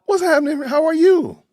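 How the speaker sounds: high, loud, excited hello; excited greeting. Real, not acted. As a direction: greet your friend with big energy. Host greets a guest.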